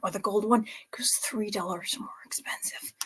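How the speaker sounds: hushed price confession; whispered aside. Real, not acted. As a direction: whisper it as a secret to viewers. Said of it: "confiding whisper about price".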